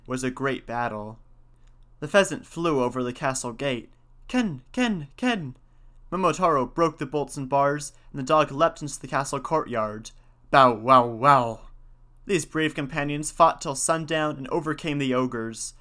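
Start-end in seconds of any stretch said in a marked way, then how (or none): 4.3-5.6 high imitated bird calls; three high pheasant cries; pheasant battle cry. A narrator's reading. 10.5-11.6 loud, low imitated barking; three loud storybook barks; dog battle bark. A narrator's reading.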